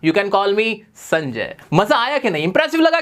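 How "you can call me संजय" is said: slow model sentence. Shown as a demonstration. Teacher modelling an English line for students, slow and clear. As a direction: spell it out slowly for learners. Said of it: "तो पूरा क्या बनेगा".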